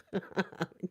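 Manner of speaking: short soft laugh; soft chuckle to yourself; brief quiet laughter. Real, not acted. Amused on live stream.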